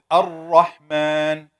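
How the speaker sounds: Ar-Rahman slow, tongue up near the gum; Arabic ra. Shown as a demonstration. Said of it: "it goes up a little bit".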